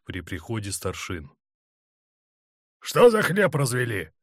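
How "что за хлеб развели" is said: raised, outraged bellow; angry roar. A narrator's reading. Colonel seeing the filthy guardroom, roaring. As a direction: roar at a slovenly crew. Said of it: "заревел Черняк".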